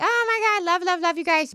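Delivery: high-pitched gushy mock adoration; gushing. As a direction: gush over them like a superfan. Mock gushing love for rival family.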